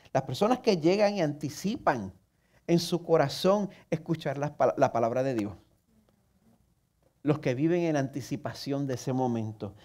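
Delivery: ordinary talk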